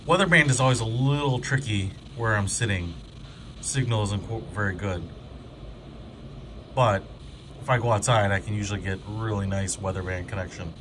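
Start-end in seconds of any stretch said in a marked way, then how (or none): none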